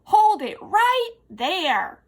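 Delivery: loud, slow, high command; forceful shouted order; roaring command. A narrator's reading. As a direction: hold the elephant with a roar. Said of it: "she roared".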